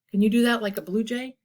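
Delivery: ordinary delivery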